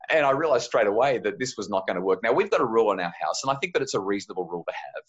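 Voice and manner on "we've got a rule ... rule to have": usual speaking voice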